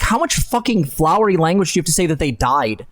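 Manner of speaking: ordinary talk, nothing marked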